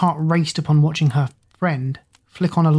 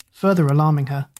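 plain reading voice

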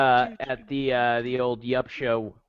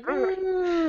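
high nasal nerd hum; mock nerdy hum with glasses pushed up; 3 dB quieter than usual. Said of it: "the glasses like".

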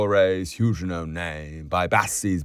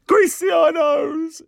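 very high, loud yell; piercing gleeful screech; hilarious shriek; 6 dB louder than usual. A narrator's reading. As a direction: scream out the silly nickname. Laughter peaks, barmaids shrieking nickname.

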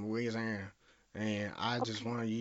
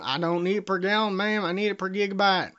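louder, higher-pitched exasperated correction; indignant insistence; 7 semitones higher, 6 dB louder than usual. Acted. Prank caller correcting a gas clerk's price, indignant insistence. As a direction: insist hotly, as if the clerk is missing the obvious.

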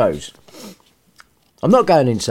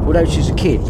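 ordinary delivery, nothing marked

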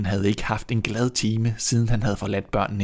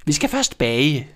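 slow, higher, louder witch voice; sly witch voice; 5 semitones higher, 3 dB louder than usual. Acted. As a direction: drawl the plan like a scheming witch. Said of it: "sagde heksen".

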